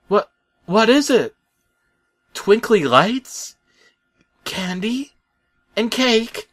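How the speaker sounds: put-on very high, eager kid voice, slowing on twinkly lights; excited child. Truck guessing the gift from Bob, in a very high excited child voice. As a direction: beg like a kid for a treat.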